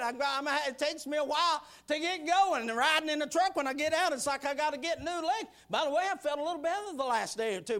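ordinary talk

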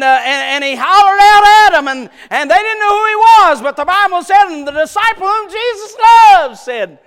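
loud, high, fast sermon shout; hollering Baptist preacher in full cry; full-force preaching. Acted. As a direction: preach it out to the back pew.

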